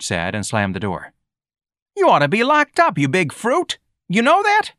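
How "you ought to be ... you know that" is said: very high, loud taunting; angry street kid. A narrator's reading. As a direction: berate him like a furious boy. George berates Ignatius.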